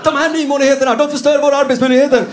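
high, loud indignant shepherd cry; angry shepherd. Acted. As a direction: protest shrilly against the fences.

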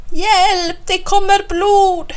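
high, loud, drawn-out cry of alarm; child's scream for help. A narrator's reading. Snurran raises a false alarm over ketchup blood, screaming. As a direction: cry out in mock emergency, stretching the words. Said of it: "skriker jag".

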